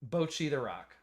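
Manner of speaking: "Bocchi" as "BOH-chee" in "Bocchi the Rock"; American pronunciation. Shown as a demonstration. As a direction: demonstrate "Bocchi" with a long oh. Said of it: "Say how you say the title".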